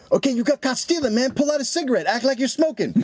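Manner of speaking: high-pitched mock inner voice; hammed-up high voice of quick scheming; thief's panicked brain. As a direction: play the thief frantically coaching himself. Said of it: "his brain went".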